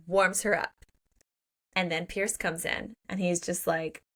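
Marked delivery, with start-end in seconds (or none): none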